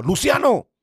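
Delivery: loud high call of the name. Retold or imitated. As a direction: call his name from far, loudly. A voice calls his name. (llamada fuerte y aguda)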